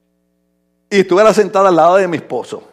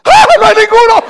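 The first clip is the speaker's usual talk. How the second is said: very high, loud cry of feigned dismay; mock shocked cry; 14 semitones higher, 7 dB louder than usual. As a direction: wail it out in mock disbelief. Mock shock at no men answering.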